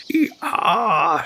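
high pained hee-haw; painful donkey bray. Acted. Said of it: "schreit … schmerzvoll". (schmerzvolles, hohes Eselsgeschrei)

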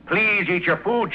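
slow, high mock of her sweet coaxing; a sarcastic parody of the jailer; mocking Donna. [name] mocking Donna's coaxing to his friend, high and sarcastic. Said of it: "she kept saying".

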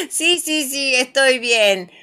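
risa aguda que pasa al habla; ríe y contesta quitándole importancia; entre risas. A narrator's reading. Papá ríe tras caer. (riendo, con voz más alta)

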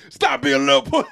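high, loud mock stern father; Joe Jackson impression. Splinter berating his son, a hammed-up stern father voice. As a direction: belt it out like a hard-driving stage dad. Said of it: "doing his best Joe Jackson".

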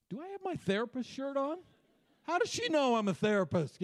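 high, mock-startled inner thought; mock disbelief. Pastor recalling his surprised thought, mock astonished. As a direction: act baffled that she guessed his job. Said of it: "I'm like".

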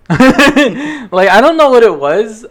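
laughing. Real, not acted. Laughing rant about a face.

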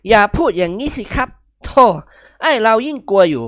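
mock-scared pleading; jumpy whining protest; startled fear. A narrator's reading. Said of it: "กิมหงวนสะดุ้งโหยง".

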